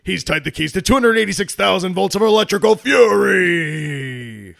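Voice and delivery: loud, shouted announcer hype; monster truck ad. Acted. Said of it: "that was hard for me to get out".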